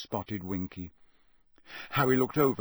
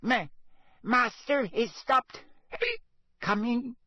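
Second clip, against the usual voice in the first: very high, louder, halting elf voice; drunk elf stammer; 14 semitones higher, 3 dB louder than usual. Acted. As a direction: stumble tipsily over the word Master.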